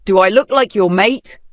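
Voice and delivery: loud, scornful challenge; indignant bark. A narrator's reading. Rich woman mocking the old lady, loud and scornful. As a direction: throw it back at her in contempt. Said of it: "Vanessa barked".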